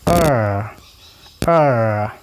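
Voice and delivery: UR held out long and falling; long vowel sound. Shown as a demonstration. Teacher comparing short and long sounds, long UR. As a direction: stretch UR as one long vowel. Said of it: "compare these short and long sound".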